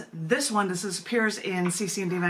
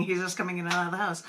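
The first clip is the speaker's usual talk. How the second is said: ordinary talk, nothing marked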